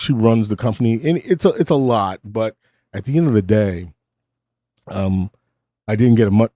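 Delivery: ordinary talk, nothing marked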